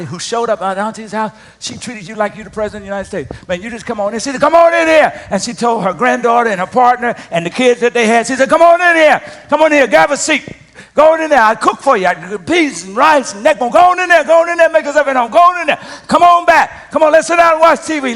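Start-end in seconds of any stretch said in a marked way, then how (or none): 4.4-5.3 calling out. Retold or imitated. Auntie welcoming guests. 8.5-18.1 loud, higher, hearty calling out; calling out welcome. Retold or imitated. Auntie hollering her granddaughter's family inside, loud welcome.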